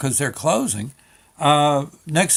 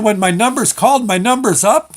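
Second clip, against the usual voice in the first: loud, high, panicky question; mock alarm; 7 semitones higher, 7 dB louder than usual. Nervous DMV customer questioning the clerk, in loud mock alarm. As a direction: blurt it out as if doomed.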